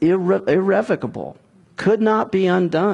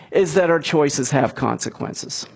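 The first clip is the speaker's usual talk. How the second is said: ordinary delivery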